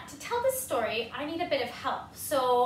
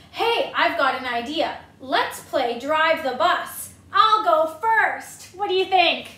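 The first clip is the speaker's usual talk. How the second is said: pigeon scheming; 8 dB louder than usual. Acted.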